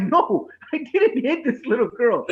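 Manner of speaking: high-pitched mock denial, half laughing; protesting innocence. Dad defending himself to ex-wife, flustered protest.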